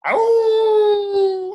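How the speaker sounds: high shrieking yelp; dog yelp. Acted. Kid as Dogman, a high yelp at bath time. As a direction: yelp like a dog afraid of water.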